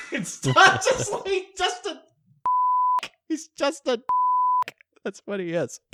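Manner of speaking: high, squeaky laughing. Real, not acted. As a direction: talk through giggles.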